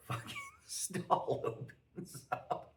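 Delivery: normal talking voice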